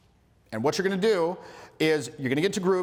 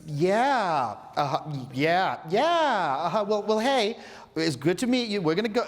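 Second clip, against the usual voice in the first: mock-polite brush-off, pitched up; uneasy politeness; 4 semitones higher than usual. Girls edging away from the wacky dude, awkwardly polite. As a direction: imitate strained, drawn-out polite agreement. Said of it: "faces go from, like".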